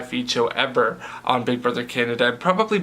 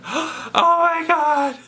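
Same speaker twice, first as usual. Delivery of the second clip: sharp gasp, then a very high, very slow, drawn-out oh my God; imitating a shocked gasp; much slower than usual. Erica reacting to the eviction, imitated with a high drawn-out gasp. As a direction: mimic a houseguest gasping in disbelief.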